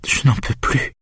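high, thin, tearful voice; understated wail of despair; sobbing moan. A narrator's reading. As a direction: whimper it, barely voiced. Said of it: "Elle pousse de longs sanglots gémissants".

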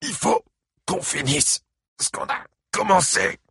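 high, choked croak; strangled croak. A narrator's reading. Buddy Lee being choked by Ike, croaking. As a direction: force the words out of a crushed throat. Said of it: "croassa Buddy Lee".

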